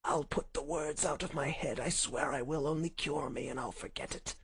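soft, feeble begging; weak pleading whisper. Acted. Sick Holmes begs for a cure, weak and hushed. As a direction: promise faintly, desperate for help. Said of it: "he whispered".

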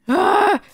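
short high scream of fright; scared scream. Real, not acted. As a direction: yelp as if hit. Hit by skeleton.

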